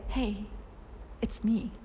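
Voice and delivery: soft, intimate hello from a loved one; intimate and sweet. Pretend. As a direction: say it gently, like a familiar voice on the phone. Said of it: "What an intimate and sweet unveiling".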